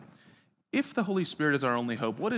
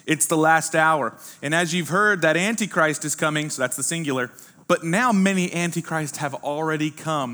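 scripture reading; 3 semitones higher, 4 dB louder than usual. Acted. Reads scripture aloud.

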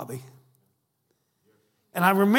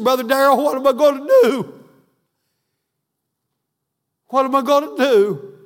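higher and louder, a lightly voiced grieving widower; retold crying plea; 8 semitones higher, 9 dB louder than usual. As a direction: echo a grieving friend's cry, without full tears. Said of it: "And he started crying".

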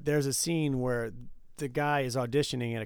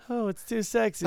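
Austin Powers; 10 semitones higher, 3 dB louder than usual. Retold or imitated. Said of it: "I have to do Austin Powers".

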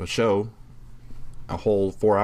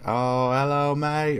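imitating an Aussie bloke on the phone; higher, cheery Aussie hello mate; Australian; 6 semitones higher, slower than usual. Host quoting Shaun of the Dead scene in Australian. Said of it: "He's like".

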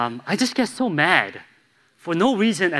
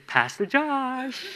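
higher, slow, laughing mock of his wife; teasing wife; 11 semitones higher, much slower than usual. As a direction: drag out the title, half laughing. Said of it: "sometimes she would say".

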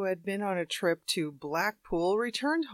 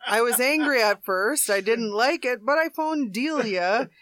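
laughing through quote; 4 semitones higher, 6 dB louder than usual. Real, not acted. Reading man's quote while laughing.

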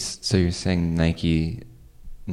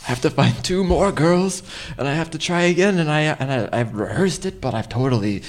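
flustered whine; 10 semitones higher than usual. Acted. Flustered whining after failure.

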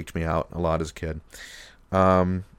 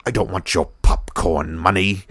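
sinister retort. Retold or imitated. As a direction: sneer it like a villain. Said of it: "Doctor Vink retorts back".

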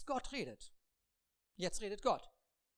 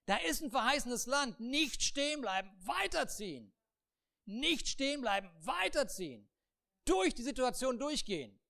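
loud, higher command; God commanding; 4 semitones higher, 4 dB louder than usual. Acted. God commands Moses the people must move on. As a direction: order it firmly.

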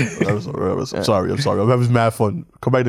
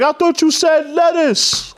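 higher, slow mock deli worker; mock deli guy; slower than usual. Host imitating the deli worker getting his order wrong, high and slow. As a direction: play the confused deli guy, drawn out. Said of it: "I would be like".

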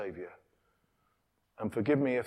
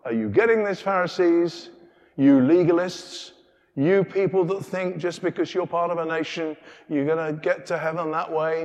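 loud, higher challenge to Pharisees; preaching challenge; 4 semitones higher, 6 dB louder than usual. Acted. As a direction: call them out from the pulpit. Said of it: "it's almost as if Jesus is saying".

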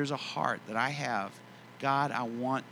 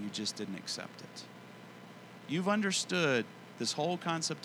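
soft, lowered, tender reassurance; gentle voice of God; 3 semitones lower, 7 dB quieter than usual. Retold or imitated. The Lord answers a grateful man in prayer, gently. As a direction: speak softly and low, as a fatherly Lord. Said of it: "the Lord just gently spoke to me".

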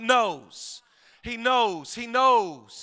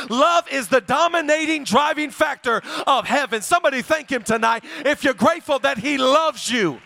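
preaching at full force; 3 semitones higher, 6 dB louder than usual. Acted. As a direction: build it up to a shout. Preaching climax, loud and sung.

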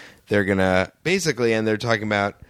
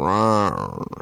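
dinosaur voice; 3 semitones lower than usual. Retold or imitated. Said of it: "keeps mimicking that".

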